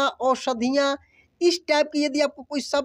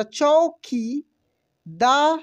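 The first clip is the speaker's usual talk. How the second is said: चौकीदार pulled apart very slowly; slow sounding out; much slower than usual. Shown as a demonstration. Teacher spelling चौकीदार aloud for students, very slow. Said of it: "च में औ की मात्रा".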